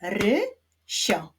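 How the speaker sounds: "Rysio" as RY... SIO, two separate beats; "Rysio" split, rising ry then falling sio; syllable by syllable. Shown as a demonstration. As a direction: clap out "Rysio" with a pause after ry. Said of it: "wyklaszczmy sylaby w imieniu Rysio".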